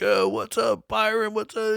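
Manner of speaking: high, bro-ish teasing greeting; high mock greeting. Smoking in the locker room, teasing Byron with a high goofy greeting. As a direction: greet him pitched high like a goofy teen.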